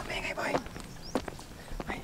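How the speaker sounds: hushed whisper to a friend. Real, not acted. Vlogger warning his friend they're too noisy, in a whisper. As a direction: whisper it so nobody hears. Hushing friend near people.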